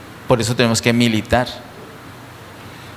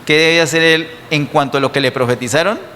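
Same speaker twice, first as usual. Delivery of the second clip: ordinary talk, nothing marked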